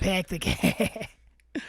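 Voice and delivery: very slow, stretched Boston "pack the car"; badly faked Boston accent, vowels dragged out; exaggerated Boston attempt. Shown as a demonstration. Stubbornly repeating it for laughs, drawn-out fake Boston accent. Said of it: "You gotta get the I's".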